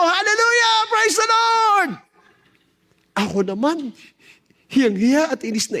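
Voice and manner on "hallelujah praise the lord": much higher, louder joyful church shout; crowd shouting praise. Retold or imitated. Preacher mimicking the church crowd's ecstatic shouting. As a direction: cry it out like a cheering congregation. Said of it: "Naghiyawan na mga tao".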